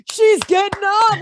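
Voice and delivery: high, loud, thrilled shout; excited dad yell. Retold or imitated. Dad shouting the news to the family, high and loud. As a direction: yell it like a dad losing it with joy. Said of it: "My dad starts screaming and jumping".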